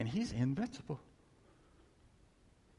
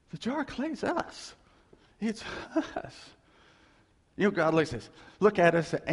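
usual speaking voice

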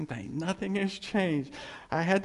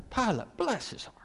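Southern pity; 4 semitones higher than usual. Retold or imitated. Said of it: "down south".

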